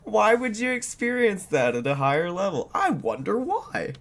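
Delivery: high mocking sarcasm; sarcastic. Real, not acted. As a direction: needle her with fake wonder. Mocking rhetorical questions.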